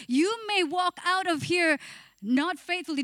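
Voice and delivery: normal talking voice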